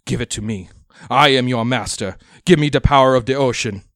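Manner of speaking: loud, high, furious command; commanding shout. Acted. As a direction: order it like a master. Captain commanding the stone.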